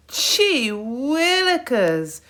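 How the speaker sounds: slow, high, loud groaned complaint; groaning. A narrator's reading. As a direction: drag it out in a groan. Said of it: "groaned Hector".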